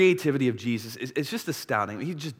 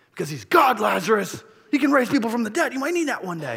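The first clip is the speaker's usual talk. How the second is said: high, loud mock indignation; exasperated retort; 9 semitones higher, 4 dB louder than usual. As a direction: act out snapping back at a nosy brother. Acted retort to Lazarus in kitchen.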